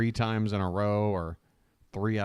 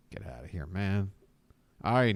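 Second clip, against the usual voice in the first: usual speaking voice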